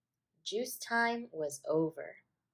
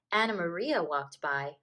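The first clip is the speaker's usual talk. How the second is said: plain reading voice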